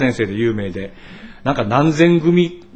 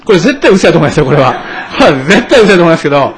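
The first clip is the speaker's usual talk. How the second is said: laughing; 5 semitones higher, 10 dB louder, faster than usual. Real, not acted. Teasing joke about old pastor.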